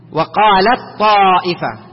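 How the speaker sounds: slow "وقالت طائفة", ت silent inside a doubled heavy ط; ت merged into ط. Shown as a demonstration. Teacher models assimilation, ت unpronounced before ط. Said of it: "لا ينطق".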